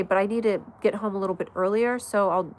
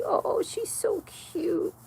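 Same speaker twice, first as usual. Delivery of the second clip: cooing to dog; 10 semitones higher, 4 dB quieter, much slower than usual. Real, not acted. Cooing over her dog.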